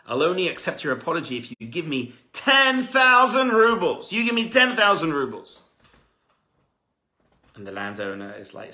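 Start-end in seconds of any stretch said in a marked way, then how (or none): 0.1-5.6 raised, insistent terms; firm demand. Acted. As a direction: lay down the price, no bargaining. Young man demands payment.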